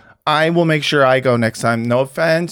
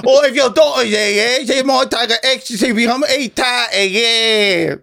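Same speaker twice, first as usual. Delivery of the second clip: put-on higher, cheeky rough British accent; mock rough British accent; 10 semitones higher than usual.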